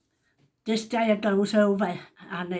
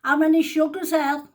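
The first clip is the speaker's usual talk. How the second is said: raised call to the next room; shouting through; 7 semitones higher, 7 dB louder than usual. Retold or imitated. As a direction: call it out loud through the wall. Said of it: "I shouts through to her".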